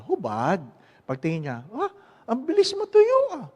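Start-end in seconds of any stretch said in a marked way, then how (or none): 1.7-3.5 high, loud, exaggerated doctor's exclamation; surprised doctor. Doctor marvels at how fast the wound dried, high and loud. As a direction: squeal it like an astonished doctor.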